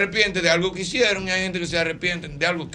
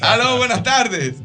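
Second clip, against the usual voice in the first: slow, higher on-air hello; radio host phone greeting; 6 semitones higher, 3 dB louder, much slower than usual. Acted. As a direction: answer the call like a radio host.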